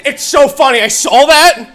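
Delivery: normal talking voice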